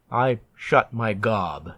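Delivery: ordinary delivery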